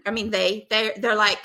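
ordinary talk

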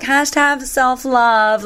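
high, drawn-out mock influencer chant; sing-song Instagram parody; mocking slogan. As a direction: stretch it out sweetly in mockery. Mocking Instagram self-love slogan.

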